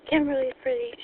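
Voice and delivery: ordinary talk, nothing marked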